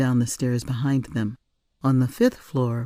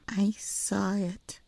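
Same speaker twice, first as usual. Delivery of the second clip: put-on slow, much softer child's whisper; 7 semitones higher, 7 dB quieter, slower than usual. A narrator's reading. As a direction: confide a secret. Girl confiding secret.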